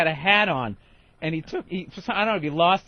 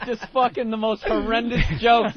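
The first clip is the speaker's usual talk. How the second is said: ordinary talk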